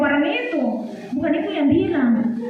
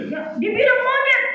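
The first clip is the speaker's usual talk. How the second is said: ordinary talk, nothing marked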